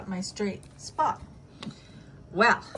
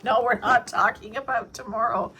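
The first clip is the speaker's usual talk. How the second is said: louder mock retort voice; mock Dex retort; 4 semitones higher, 8 dB louder than usual. Putting words in Dex's mouth, louder teasing mock voice. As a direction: playfully voice your friend objecting. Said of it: "He's like".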